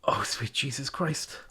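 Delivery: stunned. Real, not acted. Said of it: "I'm gonna cry".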